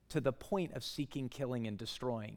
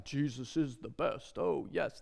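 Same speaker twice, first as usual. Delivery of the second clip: mock pious tone. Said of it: "I'm not saying that like".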